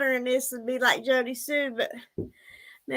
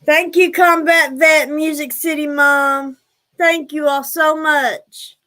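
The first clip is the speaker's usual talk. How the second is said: warm; 2 semitones higher, 8 dB louder, slower than usual. Real, not acted. Thanking donors on stream.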